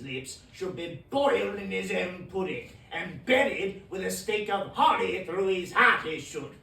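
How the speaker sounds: building rant. Acted. Scrooge raging against Christmas fools, building to loud fury.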